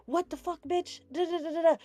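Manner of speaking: high, hammed-up mock cussing; mock angry stranger.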